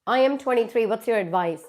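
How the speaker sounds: ordinary talk, nothing marked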